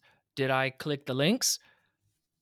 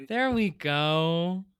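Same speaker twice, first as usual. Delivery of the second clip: relieved; 5 semitones higher, 7 dB louder, much slower than usual. Real, not acted. After popping jaw back.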